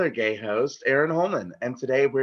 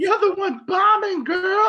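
sassy drag queen voice; 6 dB louder than usual. Acted.

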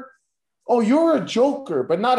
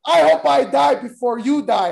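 mock rant; 5 semitones higher, 5 dB louder than usual.

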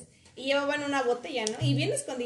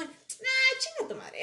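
much higher, sneering mock voice; much higher, dismissive impression; mocking a rude guy; 11 semitones higher, much slower than usual. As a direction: sneer it as a caricature of the jerk. Imitating rude guy's reply.

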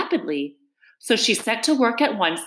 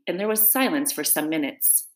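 plain reading voice

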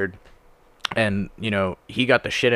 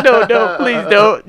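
higher, louder mock begging; pleading; 12 semitones higher, 8 dB louder, slower than usual. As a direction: beg him, hammed-up. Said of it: "I was like".

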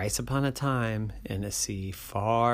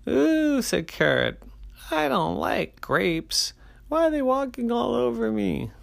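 complaining carrot; 13 semitones higher, 3 dB louder than usual. Acted.

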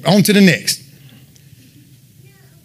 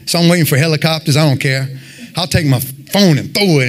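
ordinary talk, nothing marked

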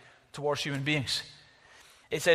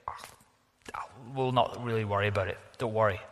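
lower, breezy brush-off; a mock casual "don't worry" in a lower voice; easygoing shrug; 6 semitones lower, 3 dB quieter than usual. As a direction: wave it away lightly, lower. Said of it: "you're easygoing".

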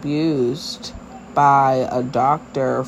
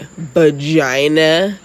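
"Vagina" stretched very slowly, louder, rising into a question; retold child's pointed word; 5 dB louder, much slower than usual. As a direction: draw out "Vagina" as an imitated innocent question. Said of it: "it was because how she said it".

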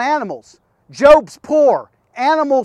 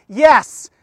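ordinary delivery

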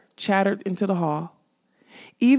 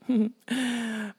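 soft, teasing chuckle; short mocking chuckle in character; skull's chuckle; 4 semitones higher, 5 dB quieter than usual. A narrator's reading. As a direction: laugh softly at their expense. Skull teasing Molly with a laugh.

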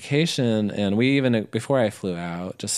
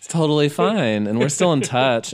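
laughing insistence; 7 semitones higher, 7 dB louder than usual. Real, not acted. Laughing insistence after joke.

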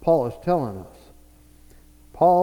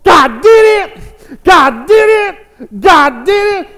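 very high, loud, drawn-out yell; acted overjoyed widow drowning out objections; jubilant shouting; 13 semitones higher, 14 dB louder, slower than usual. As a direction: shout it slowly in triumph. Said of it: "And she's going".

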